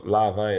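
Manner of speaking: Larvae said as two syllables, LAH-vay; old-habit pronunciation. Shown as a demonstration. Interviewer admits his habitual name for the system, Larvae as LAH-vay. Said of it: "as I'm used to calling it".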